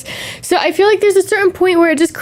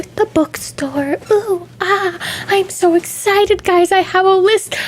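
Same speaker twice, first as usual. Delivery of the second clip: swooning excitement; 5 semitones higher, slower than usual. Acted.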